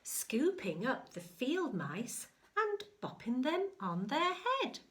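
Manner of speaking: sing-song picture-book telling; storybook narration. Acted. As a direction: build the excitement for a child.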